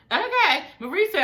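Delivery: ordinary delivery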